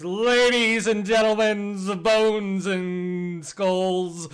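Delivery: booming, slow, drawn-out announcer; fighting game announcer. Acted. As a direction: hype up the crowd, slowly. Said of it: "an announcer voice emanates".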